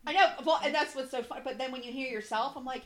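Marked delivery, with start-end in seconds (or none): none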